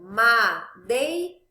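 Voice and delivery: "madeira" split slowly into MA, DEI, RA, loud; syllable by syllable. Shown as a demonstration. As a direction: break "madeira" into three drawn-out beats. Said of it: "quando eu separo".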